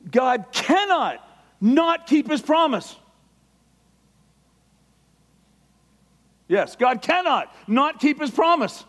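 loud, high, impassioned preaching; excited shouting sermon; fervent preaching shout. Real, not acted. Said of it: "My voice raises".

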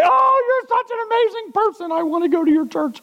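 high, gushing voice of an impressed stranger; gushing admirer. Pretend. A person praising the preacher in a high gushing voice. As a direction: gush praise like a starstruck newcomer.

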